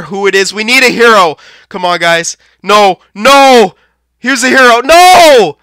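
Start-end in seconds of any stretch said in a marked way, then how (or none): 2.6-5.6 rising cries of no, louder each time; despairing yell at the screen; anguished shout. Real, not acted. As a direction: yell no as the game is lost. Opponent scores winning goal.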